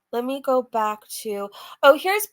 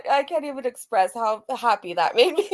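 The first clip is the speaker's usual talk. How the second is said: usual speaking voice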